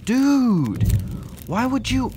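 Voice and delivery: outraged cry at the seller over the packing; real shocked shout. Real, not acted. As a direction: yell the question in real disbelief. Shocked at how the spider was packed.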